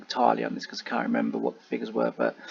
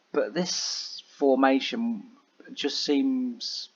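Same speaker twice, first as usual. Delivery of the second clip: normal talking voice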